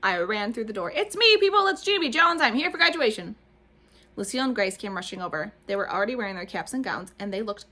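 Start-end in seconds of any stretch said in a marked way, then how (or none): 0.9-3.4 put-on high, loud little-girl announcement; excited little girl. A narrator's reading. Junie announcing herself to the kindergarten class, high and loud. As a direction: burst in shouting your own name.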